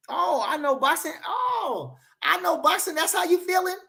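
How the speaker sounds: high-pitched mocking whine; mocking imitation. Streamer mocking a chatter's boxing claim, high mocking whine.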